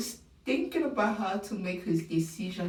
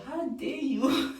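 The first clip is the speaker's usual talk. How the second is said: higher, slow indignant how dare you; mock outrage; 6 semitones higher, slower than usual. Fan confronting the Bachelor, hammed-up outrage. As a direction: drag out the outrage theatrically. Scolding Grant in imagined reply.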